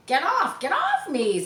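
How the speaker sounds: loud, high shout. Acted. Sidney shaking Harry off his back, shouting. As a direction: yell to throw him off. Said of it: "Sidney shouted".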